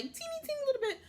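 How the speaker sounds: very high, small playful squeak; tiny squeaky voice. Real, not acted. Vlogger grudgingly admitting slight agreement, tiny squeaky voice. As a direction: shrink the voice to match the smallness.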